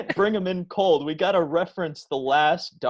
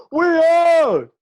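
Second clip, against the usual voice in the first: loud, high rock star hype; hyped crowd warm up; 11 semitones higher, 7 dB louder, slower than usual. Pretend. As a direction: pump up the crowd. Rock frontman greeting the crowd.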